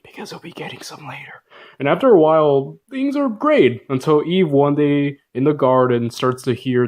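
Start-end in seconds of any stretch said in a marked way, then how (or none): 0.0-1.4 whispered aside; a sly whispered joke. Acted. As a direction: lean in and whisper it. Sly aside about Adam.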